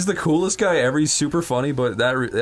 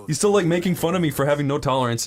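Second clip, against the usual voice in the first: usual speaking voice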